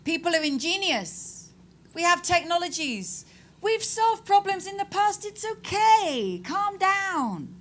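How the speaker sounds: high, soothing, mocking reassurance; mock complacent optimist. An optimist's mock voice soothing a worried audience. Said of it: "don't be an optimist if that makes you relax".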